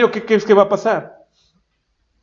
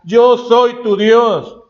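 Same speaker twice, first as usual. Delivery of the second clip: God declaring; 5 semitones higher, 4 dB louder, much slower than usual. Acted. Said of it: "le dice".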